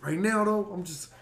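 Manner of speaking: high, loud imitation of a buddy; Tim impression. Host mimicking his boxer friend's excuse for not coming, high and loud. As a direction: do your pal's voice, pushed up and loud. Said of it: "That's how he tr".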